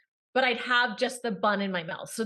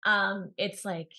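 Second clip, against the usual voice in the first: ordinary talk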